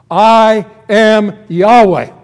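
loud, high, slow word-by-word declaration; very emphatic. Acted. God declares his name to Moses, very emphatically. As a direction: proclaim each word with weighty force. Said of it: "he says that very emphatically".

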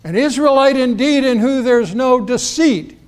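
high, loud ringing greeting; loud call. Retold or imitated. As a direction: call it out so he hears. Jesus greeting Nathanael again.

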